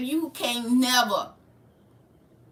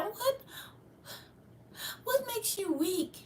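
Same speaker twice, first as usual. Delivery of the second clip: soft, high, cooing mockery; mock a sweet soft-spoken woman; put-on gentle, high voice; soft-spoken temptress; 8 semitones higher, 11 dB quieter than usual. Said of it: "the ones that talk like that".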